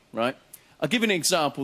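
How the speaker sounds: ordinary delivery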